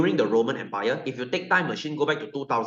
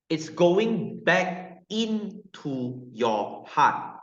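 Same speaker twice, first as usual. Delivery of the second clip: drawn out; 3 semitones higher, much slower than usual. Shown as a demonstration. Teacher draws out key point.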